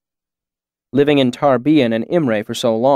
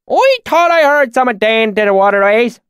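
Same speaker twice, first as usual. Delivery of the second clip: high, loud thick rural dialect; thick deep valley accent; 11 semitones higher, 5 dB louder than usual. A narrator's reading. Swineherd greets strangers in thick valley accent. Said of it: "his accent so thick and oily you could almost taste it".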